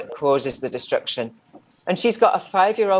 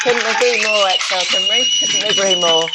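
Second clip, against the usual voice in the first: louder, called out across the crowd; loud agreement; 3 semitones higher, 5 dB louder than usual. Real, not acted. Host backing the speaker, loud called-out agreement. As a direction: cheer it out.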